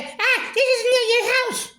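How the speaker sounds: high, slow mocking squeak; mimicking her reply. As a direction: throw her words back in a high put-on voice. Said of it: "And she said".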